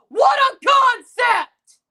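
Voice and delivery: loud, slow, mocking exclamation; sarcastic shout. Real, not acted. Fan mocking a rival team, loud and slow. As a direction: yell it sarcastically, dragging it out.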